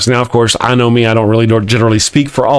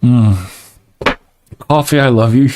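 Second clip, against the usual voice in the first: ordinary delivery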